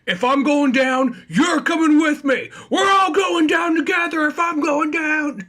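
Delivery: hammed-up desperate threat, high and loud; threatening shout. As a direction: yell it slowly, dragging everyone down with you. Acting Bruno threatening his accomplice.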